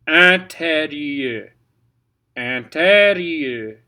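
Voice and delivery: "Intérieur" slow, with a nasal an; loud, slow "Intérieur" demonstration; pronunciation demo. Shown as a demonstration. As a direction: stretch "Intérieur" slowly, nasal first vowel. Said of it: "Here's how you pronounce it".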